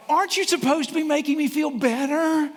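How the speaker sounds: mock audience complaint. Voicing a doubting audience member.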